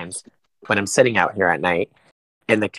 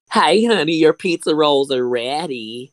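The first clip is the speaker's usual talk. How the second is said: higher, loud sweet call down the stairs; mom calling down; 5 semitones higher, 3 dB louder, slower than usual. Pretend. Mom calling her son up for pizza rolls. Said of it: "yell down the stairs".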